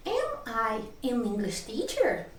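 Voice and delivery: slow, model question tune on "Am I an English teacher"; teacher demonstrating the tune of "Am I an English teacher"; question intonation. Shown as a demonstration. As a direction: ask "Am I an English teacher" slowly, with clear question melody. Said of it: "quando é pergunta é o mesmo tipo".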